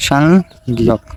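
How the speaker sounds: সাংগিয়ক slowly, split into two parts with a long gap; slow pronunciation demo. Shown as a demonstration. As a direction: draw out সাং, pause, then গিয়ক. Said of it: "একটার উচ্চারণ আছে". (সাংগিয়ক ধীরে ভেঙে ভেঙে উচ্চারণ)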